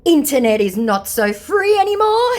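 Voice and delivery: high mocking taunt. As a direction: jab at him with a sneer. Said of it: "he asks".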